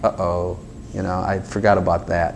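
low, soft acted uh-oh; sheepish realisation. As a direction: back down quietly, as if caught out. Acted sheepish realisation after boasting.

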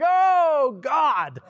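high, wailing oh God lament; a comic, high-pitched cry of remorse; mock anguished regret. Retold friend's regretful cry to God.

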